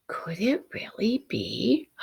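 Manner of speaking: slow whispered disbelief; hushed wonder. A narrator's reading. Alexander spotting the pebble, whispered wonder. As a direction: barely breathe it, not daring to believe.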